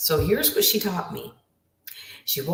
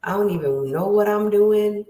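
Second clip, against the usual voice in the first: higher-pitched mock lament; lost and exasperated; 6 semitones higher than usual. Voicing listeners who don't know their purpose, lost and exasperated. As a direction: wail it a little, as someone adrift.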